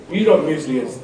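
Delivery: ordinary delivery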